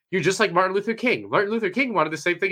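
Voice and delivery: lightly mock him with false admiration; mild mocking. Interviewer teasing the guest with a Martin Luther King comparison, mildly mocking. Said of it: "she's, like, mocking him".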